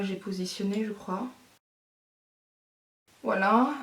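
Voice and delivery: ordinary talk, nothing marked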